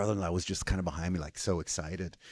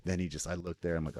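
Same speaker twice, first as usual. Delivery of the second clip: ordinary talk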